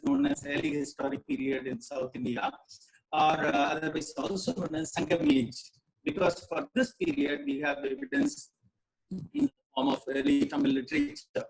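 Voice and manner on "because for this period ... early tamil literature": crackling, clipped audio; clipping distorted mic. Real, not acted. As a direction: voice breaking up on a bad mic. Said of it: "Your audio is crackling a lot".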